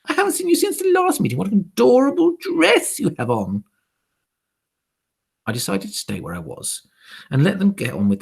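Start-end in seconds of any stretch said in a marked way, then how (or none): 0.0-3.6 very high, loud, gushing greeting; high, sing-song society chatter; gushing lady. Retold or imitated. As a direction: trill it like an over-sweet lady greeting a friend. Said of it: "there was a whole lot of stuff like".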